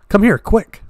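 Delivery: high, loud urgent call; crying out. A narrator's reading. Daisy calls from the window, crying out. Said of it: "cried Daisy at the window".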